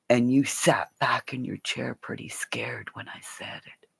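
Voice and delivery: voiceless hushed taunt; taunting whisper. Real, not acted. Streamer reminding Steve how scared he was, in a taunting whisper. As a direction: whisper it like a secret he knows.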